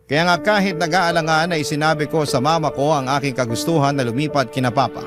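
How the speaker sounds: ordinary talk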